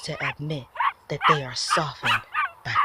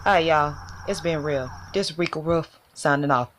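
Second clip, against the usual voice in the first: reporter sign-off; 7 dB louder than usual. Acted. Reporter Rico Ruff signs off.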